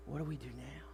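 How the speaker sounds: very soft, stunned question; acted hushed uncertainty; awkward hush. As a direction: murmur it into an awkward silence. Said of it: "there's silence going on right now".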